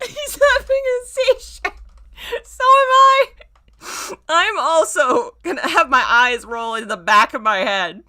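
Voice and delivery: shrieking high through laughter; words squeezed out through hysterics; hysterical laughing. Real, not acted.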